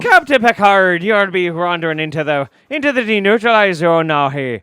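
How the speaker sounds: a Romulan general hailing Picard in brogue; mock Irish Romulan. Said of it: "he'd show up ... and be like".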